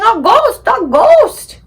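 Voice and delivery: high, loud cries of alarm; panicked yelling. Acted. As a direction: crowd shrieking at an apparition. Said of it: "everybody yelling".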